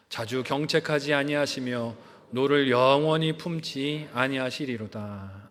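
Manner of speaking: slow, measured reading aloud with the congregation; slow scripture reading. Real, not acted. Pastor leading the congregation in reading the psalm, slow solemn reading. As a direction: read the psalm slowly, in unison. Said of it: "한 목소리로 읽어보도록 하겠습니다".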